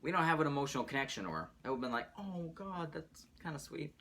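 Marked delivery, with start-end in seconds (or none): 2.2-3.9 higher, much softer half-hearted "aww"; mock unimpressed. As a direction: shrug it off softly, barely moved. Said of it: "that's literally what my reaction would've been".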